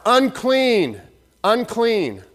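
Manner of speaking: high, loud cry of unclean; yelling out a warning. Retold or imitated. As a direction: call out the warning to everyone nearby. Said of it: "you had to yell out".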